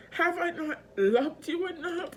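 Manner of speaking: high-pitched crying plea; tearful pleading. Real, not acted. Asking the partner who hurt them, tearfully pleading. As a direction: plead through real tears.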